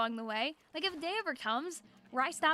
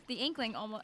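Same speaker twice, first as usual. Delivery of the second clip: usual speaking voice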